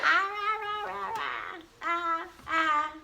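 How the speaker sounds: high babbled mock roars; string little play roars together up high; playful roaring. Play-yelling to amuse the baby, high mock roars.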